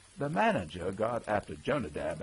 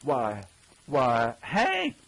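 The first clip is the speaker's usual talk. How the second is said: rising, startled recognition ending high on Hank; astonished greeting shooting up on the name; surprised cry; 4 semitones higher, 7 dB louder, much slower than usual. A narrator's reading. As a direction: blurt it out in shocked recognition.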